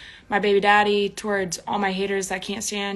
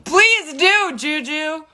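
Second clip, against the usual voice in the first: loud excited reply; 7 semitones higher, 6 dB louder, much slower than usual. Real, not acted. Reacting to viewer comment.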